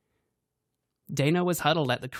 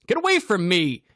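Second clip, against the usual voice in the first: loud, higher yell; 6 semitones higher, 8 dB louder than usual. A narrator's reading. Grant yelling at Cassidy, loud. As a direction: shout him off. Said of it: "Grant yelled".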